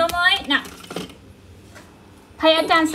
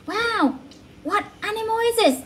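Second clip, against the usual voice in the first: excited; 6 semitones higher than usual. Real, not acted. Mother quizzing toddler with pictures.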